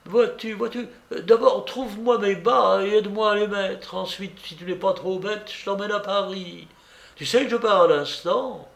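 a hint of a teasing young woman; Blanche.

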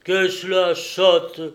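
loud, slow, savage exclamation; fierce, forceful cry; savage outburst. A narrator's reading. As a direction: roar it at the girl, drawn out. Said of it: "s'écria Bourbeau d'une voix sauvage".

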